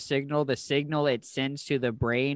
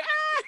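very high, squealed imitation scream; mock scream. Imitating her pointing at Hulk, mock scream. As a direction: squeal a thin mock shriek. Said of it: "pointing at Hulk and screaming".

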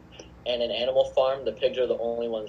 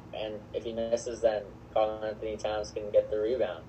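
ordinary talk, nothing marked

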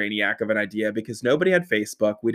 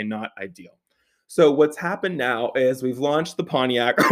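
ordinary talk, nothing marked

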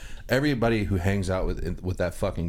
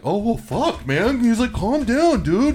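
mock drunk kid; 7 dB louder, slower than usual. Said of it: "And he's like".

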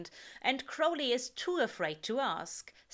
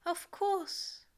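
breathless; 10 semitones higher than usual. A narrator's reading. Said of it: "Aziraphale says breathlessly".

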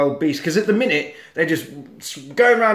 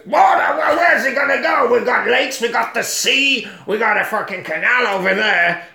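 walrus chief voice; 7 semitones higher than usual. Retold or imitated. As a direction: bellow it up high like a flustered walrus of a chief. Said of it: "Walrus Chief goes".

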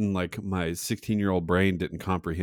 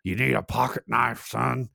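higher, put-on old-man sales pitch; imitating old regular; 5 semitones higher than usual. Old man selling stuff outside Hardee's, imitated voice. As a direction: offer it like a pushy old peddler. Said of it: "And he'd be like".

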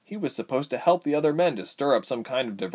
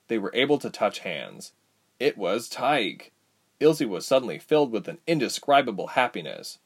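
plain reading voice

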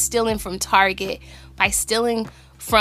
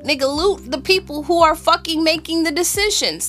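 heated, raised angry rant; 7 semitones higher than usual. Real, not acted. Heated rant about looting.

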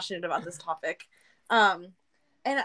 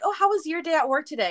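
high-pitched, interested retold question; mock-chirpy check-in; eager question; 7 semitones higher, 3 dB louder, faster than usual. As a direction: ask it keenly, pitched well up. Acting own eager question to partner.

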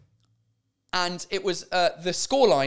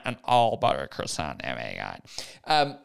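drawled, hammed-up American voice; American accent; slower than usual. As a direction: drop into a slow mock American drawl. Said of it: "Or if you're American".

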